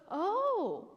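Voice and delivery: high, mocking sarcasm; sarcastic God. God mocking Job, high and sarcastic. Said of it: "he gets a little sarcastic with him".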